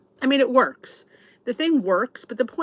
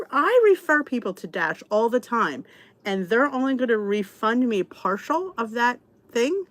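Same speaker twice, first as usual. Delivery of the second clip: ordinary talk, nothing marked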